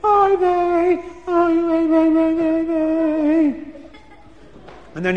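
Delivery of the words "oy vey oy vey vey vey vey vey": put-on high, thin girl's wail, oy vey; a young girl keening in grief. A narrator's reading. Daughter wails over the burned wedding money, high and keening. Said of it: "he hears the girl's voice".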